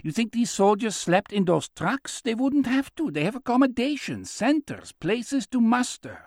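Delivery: put-on high-pitched old man's voice; insistent old man. Old man arguing about the soldiers' trucks, insistent. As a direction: argue it in a high, animated voice. Chaim explains to Buck.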